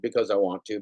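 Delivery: usual speaking voice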